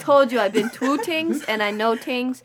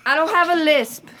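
raised, offended denial; indignant denial; 6 semitones higher, 5 dB louder than usual. Acted. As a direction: insist loudly you have no lisp. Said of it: "It really sounds like you have a lisp".

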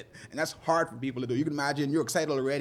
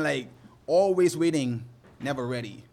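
ordinary talk

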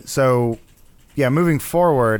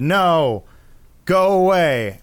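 firm mock rebuff, raised on "go away"; mock dismissal; 5 semitones higher, 3 dB louder, much slower than usual. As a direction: wave the scientist off, playing officialdom. Retold government rebuff of the hero.

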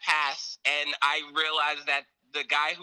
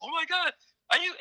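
shocked; 11 semitones higher, faster than usual. Retold or imitated. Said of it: "I was in shock".

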